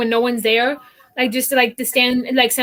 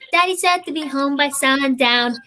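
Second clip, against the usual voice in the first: put-on little girl voice; 3 semitones higher, slower than usual. Shown as a demonstration. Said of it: "I can make it sound like a child's voice".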